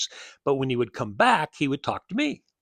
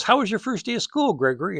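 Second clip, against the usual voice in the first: mother's question; 9 semitones higher, 4 dB louder than usual. Retold or imitated. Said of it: "she goes".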